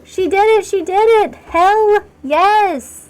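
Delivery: high, excited celebration; triumphant cheer. Real, not acted. Cheering a successful lung installation.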